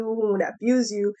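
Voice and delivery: ordinary talk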